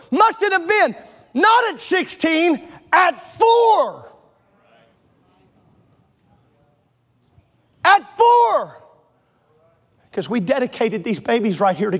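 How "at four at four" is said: indignant repeat of four; stress on four. Real, not acted. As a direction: hit four and hold it.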